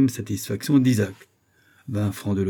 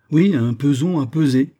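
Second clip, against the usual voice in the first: louder, higher, exasperated repeat; impatient; 6 semitones higher, 7 dB louder than usual. A narrator's reading. Professor fed up with so many formalities, impatient. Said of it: "commençaient à impatienter outre mesure".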